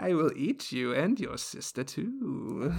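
slow, drawn-out witch threat; hammed-up witch savouring every word; fairy-tale witch. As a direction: menace them slowly like a storybook witch. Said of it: "Is this the witch from Hansel and Gretel?".

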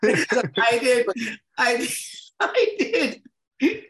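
laughing. Real, not acted. Laughing as he starts his anecdote.

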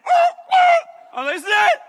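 high, shouted yelps of mock terror; hammed-up panic. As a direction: cry out as if doomed. Acts terror in turbulence.

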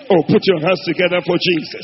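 very loud call for applause; rallying shout. Real, not acted. Preacher asking congregation to clap for Jesus, shouting. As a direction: call out to the whole church.

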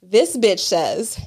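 usual speaking voice

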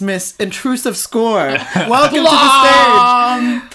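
loud, drawn-out host call; award-show announcer crying out the name; mock awards host. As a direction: announce the winner to the hall.

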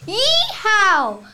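high, loud cowboy yeehaw; cowboy whoop. Acted. Cowboy riding off saved, excited whoop.

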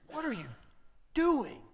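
ordinary delivery

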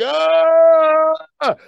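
high, loud mock scream; pained scream. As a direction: yell out in sudden pain. Gerbil victim cry.

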